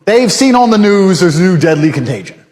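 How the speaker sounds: ordinary delivery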